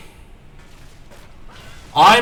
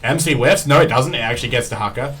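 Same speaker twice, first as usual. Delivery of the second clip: louder, higher fight casting; excited play-by-play; 7 semitones higher, 10 dB louder than usual. Acted. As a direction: call every kill with rising hype. Hype play-by-play of a teamfight.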